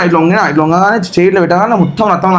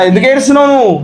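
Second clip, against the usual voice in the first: a higher, coaxing question to a crying partner; gentle teasing comfort; 7 semitones higher than usual. Real, not acted. Husband gently teasing his crying wife, coaxing and high. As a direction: coax her out of her tears.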